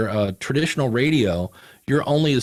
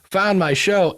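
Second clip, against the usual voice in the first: higher and faster, gushing in amazement; thrilled disbelief; 8 semitones higher than usual. Retold or imitated. Podcaster recalls hearing his first listener from abroad, excited and fast. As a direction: rush it out like you can't believe your luck. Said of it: "I'm like".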